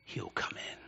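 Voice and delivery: hushed, breathy promise; whisper. Real, not acted. Preacher whispering to the congregation that he'll come in. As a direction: let it fall to a whisper.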